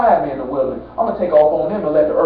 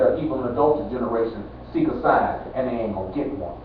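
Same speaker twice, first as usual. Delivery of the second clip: much lower and softer, grave; a low, soft scriptural rebuke; hushed solemn quote; 9 semitones lower, 8 dB quieter than usual. Retold or imitated. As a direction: drop to a low hush for the verdict. Quoting Jesus' solemn rebuke.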